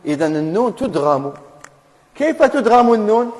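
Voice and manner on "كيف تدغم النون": ordinary delivery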